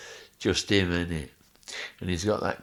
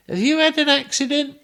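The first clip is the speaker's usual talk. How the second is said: mock advert voice; 11 dB louder than usual. Friend Joe mocking him with a claims advert. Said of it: "him saying".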